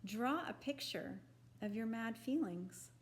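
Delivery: usual speaking voice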